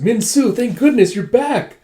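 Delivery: high, loud mock burst of relief; relieved greeting. She greets the one she awaited, relieved exclamation.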